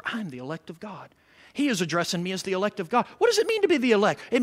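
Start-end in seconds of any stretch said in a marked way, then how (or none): 0.0-3.0 quietly savouring being chosen. Shown as a demonstration. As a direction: softly dwell on the words. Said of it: "camp on that word forever".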